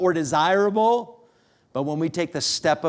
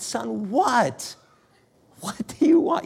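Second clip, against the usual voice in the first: exasperated retold outburst, pitched way up; frustrated dad; 7 semitones higher, slower than usual. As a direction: act out a father losing patience, voice climbing high. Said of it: "I'm getting frustrated".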